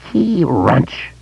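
high-pitched monster screech, held back in volume; creature's scream. A narrator's reading. Vampire screaming as its jaws snap, high screech. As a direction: screech it high, without full force. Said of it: "it screamed".

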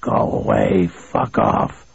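low, voiceless rasp through a door; hoarse, strained yell without volume. A narrator's reading. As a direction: rasp it out hoarsely, warning someone off. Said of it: "a hoarse voice yelled".

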